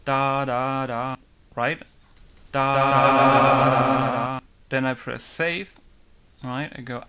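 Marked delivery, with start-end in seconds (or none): none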